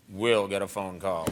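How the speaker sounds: dropped low, mocking the line; low parody echo; mock low put-on voice. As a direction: say it again in a deep goofy voice. Repeated in other voice.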